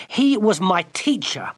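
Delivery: high furious retort; girl furiously defending her teacher. Acted. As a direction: snap back furiously. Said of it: "Gwendolen said furiously".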